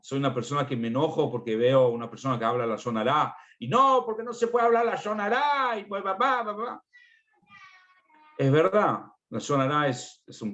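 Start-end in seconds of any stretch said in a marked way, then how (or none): 3.7-5.8 high, put-on voice of a scolding father; mock scolding parent. Padre retado por hablar Shon Hará. 5.8-6.8 high, put-on kid's voice calling papá; mock child reply. Hijo le responde al padre.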